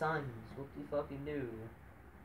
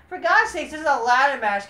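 high, loud exasperation; fed-up fan yelling about a ladder match; exasperated rant; 9 semitones higher, 12 dB louder than usual. Real, not acted. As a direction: throw up your hands at the booking. Ranting about the booking.

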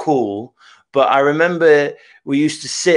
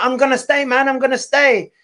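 high-pitched mocking quote of a friend; imitating the friend; 10 semitones higher than usual. Host quoting the friend's insistence on staying, high-pitched imitation. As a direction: put on a higher voice for the friend. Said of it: "And then you were like".

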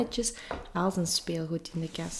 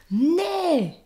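high, drawn-out shout of no; roared refusal; 10 semitones higher, 4 dB louder than usual. A narrator's reading. Small Finn to his mother, roared refusal. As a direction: bellow it like a defiant child. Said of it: "brulde luidkeels".

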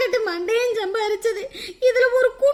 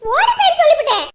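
loud protest; 5 semitones higher, 6 dB louder than usual. Acted. Quarrel during robbery.

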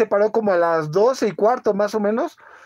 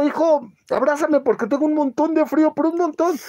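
higher retold plea, a touch of whine; shivering plea; 6 semitones higher than usual. Father to son, asking for a hug because he is freezing, higher mock-pleading voice. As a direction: beg for the hug with the voice pitched up.

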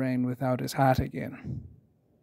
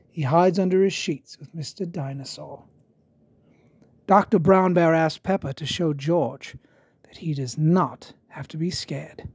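ordinary delivery

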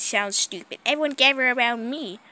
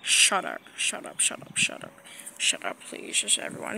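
hushed; 4 semitones lower, 8 dB quieter than usual. Acted. Hushed repeated shut up.

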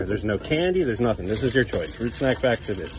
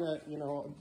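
normal talking voice

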